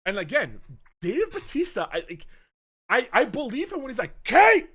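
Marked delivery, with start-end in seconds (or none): 4.2-4.7 loud, high shout for a missing daughter; panicked calling. Retold or imitated. Father calls for his missing daughter, panicked shouting. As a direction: yell the name out in acted panic. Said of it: "oh, he's panicking 'cause his daughter's not here".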